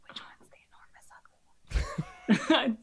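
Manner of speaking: slow unvoiced whisper to a friend; a secret slow whisper at the kobold court; whispered aside. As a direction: lean in and whisper, slowly.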